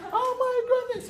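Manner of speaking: very high-pitched mock squeal; freaking out. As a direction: imitate a student squealing in panic. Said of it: "freaking out".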